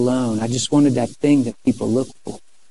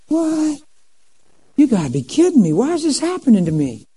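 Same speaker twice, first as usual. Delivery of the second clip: high, incredulous mock complaint; exasperated disbelief; 10 semitones higher than usual. Young man hearing she won't cross lines, exasperated. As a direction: wail it like life is unfair. Acts out his exasperated thoughts.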